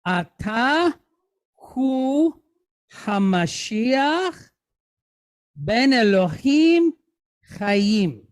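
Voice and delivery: slow, word-by-word Hebrew reading; measured model reading for students to follow; reading aloud for learners. Shown as a demonstration. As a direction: read it out slowly, pausing between phrases. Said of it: "let's read our sentence".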